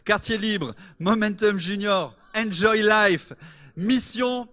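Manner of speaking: high, loud announcer, building to a shout; rousing announcer build-up. Real, not acted. Host calling the church to applaud the guest, rousing announcer shout. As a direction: build the reveal to a big shouted name for applause.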